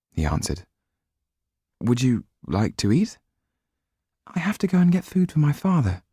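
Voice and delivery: lightly acted young couple; lightly voiced dialogue. A narrator's reading. Voices Sido and Jan.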